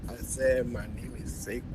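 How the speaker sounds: faint, far-off caller voice; speaking barely above a murmur; very quiet. Real, not acted. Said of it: "You have to speak louder, please".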